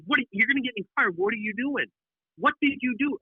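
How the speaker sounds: panicked cameraman. Retold or imitated. Cameraman panics after the shot.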